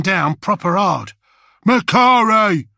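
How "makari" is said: higher, much louder, slow bellowed name; roared. Acted. Bullets roaring at the doomed grot. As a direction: roar the name in his face. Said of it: "roared Bullets".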